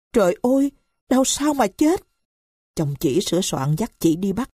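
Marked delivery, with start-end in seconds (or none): 0.1-2.0 high, startled disbelief at the news; shocked cry. Acted. Brother learning of his sister's death, shocked high cry. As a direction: gasp it out, stunned the sister is dead.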